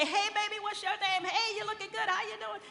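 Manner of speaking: high, loud catcalling street voice; street catcalls. Retold or imitated. Retelling men on library steps calling out to passing women, loud and high. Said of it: "And they'd say".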